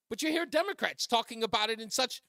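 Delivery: normal talking voice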